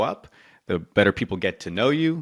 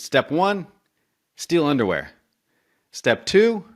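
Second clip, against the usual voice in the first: high, squeaky gnome reading the step; a gnome at the blackboard trailing off; 8 semitones higher, slower than usual. Retold or imitated. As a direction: pitch it way up like a little gnome.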